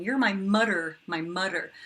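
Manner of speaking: "mudder" said with a D in place of the "th"; kid's d for th. Retold or imitated. Mom imitating her daughter saying "mudder", D for th. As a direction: say "mudder" with a D like a young child. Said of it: "a lot of kids use the D sound".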